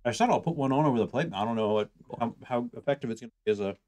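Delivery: usual speaking voice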